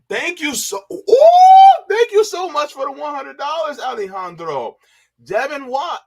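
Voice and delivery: high, loud cries of joy over the goal; shrieking with real delight; overjoyed thanks. Real, not acted. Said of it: "Yo, I'm getting emotional right now".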